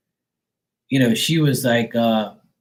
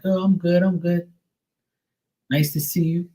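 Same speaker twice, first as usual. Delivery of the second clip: fake politeness; 6 semitones higher than usual. His own polite reply.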